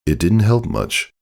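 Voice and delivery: usual speaking voice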